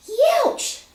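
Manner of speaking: high, loud yelp of pain; sharp yelp. Acted. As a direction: yelp as the nails dig in. Narrator's nails dig in.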